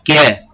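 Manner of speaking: "Quer" as que and é fused into one falling syllable; one single sound. Shown as a demonstration. Teacher repeating the junction for students, que é as one sound. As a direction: give "Quer" as a single beat, "kié". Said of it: "Você pronuncia de uma maneira só".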